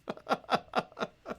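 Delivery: high, breath-only cackling; laugh. Real, not acted. Speaker amused by own gory option, laughing. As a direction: run of high chuckles.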